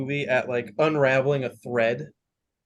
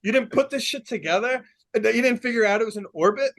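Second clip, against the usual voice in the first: higher, faster incredulous scolding; exasperated boss; 8 semitones higher than usual. Retold or imitated. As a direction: berate him in disbelief. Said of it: "Talos is like".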